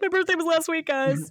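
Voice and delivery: pleading birthday guest. Pretend. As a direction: whine to convince the staff. Acted plea to staff.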